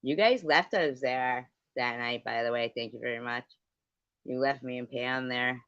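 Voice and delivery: ordinary delivery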